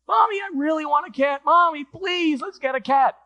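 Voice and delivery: put-on high, pleading child's voice; insistent little boy. Little Johnny begs his mother for a cat, high insistent plea. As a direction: beg Mommy in a high voice, insisting. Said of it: "Johnny kept insisting".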